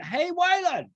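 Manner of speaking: a stranger hails him by name, shouting; calling out. Retold or imitated.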